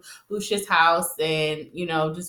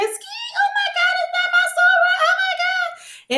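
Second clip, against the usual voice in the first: very high gushing squeal, slowing at the end; high-pitched sorority squeal; squealing excitement. Retold or imitated. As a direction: shriek with delight at an old friend. Giselle gushing over her soror.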